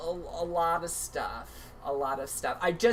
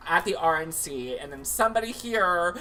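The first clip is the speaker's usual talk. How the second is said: rising higher and higher, ending in a squeak; mock Anderson Cooper report; 5 semitones higher, 4 dB louder than usual. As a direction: build from anchor voice to a high strained peak. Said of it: "He's like".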